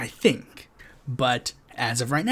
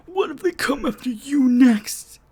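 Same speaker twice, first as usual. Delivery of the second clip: higher, whiny mocking voice; mock worried whine; 9 semitones higher than usual. Mocking people who moan about being next, a high whine. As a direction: parody a fretful critic.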